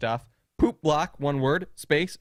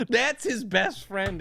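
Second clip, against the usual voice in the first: put-on slow, high-pitched old-man voice; drawn-out, higher mock grandpa; blurting grandpa; 7 semitones higher, slower than usual. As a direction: imitate grandpa announcing it to strangers.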